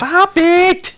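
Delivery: high, loud protest; exasperated outburst. Real, not acted. Host begging co-host to drop the joke, high exasperated cry.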